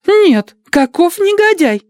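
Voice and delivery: very high, loud playful outrage; mock outraged exclaim. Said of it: "воскликнула молоденькая Адати".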